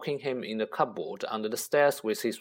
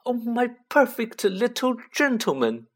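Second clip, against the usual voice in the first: high, doting aunt voice; lightly voiced sniffing aunt; Aunt Petunia voice; 11 semitones higher than usual. A narrator's reading. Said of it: "sniffed Aunt Petunia".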